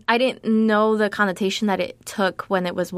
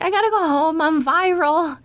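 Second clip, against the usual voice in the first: excited urgency; 8 semitones higher than usual. Retold or imitated. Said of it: "being like".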